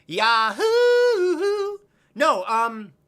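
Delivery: high, loud sung yahoo; hammed-up cowboy yell; cowboy whoop. Cowboy whoop after accent.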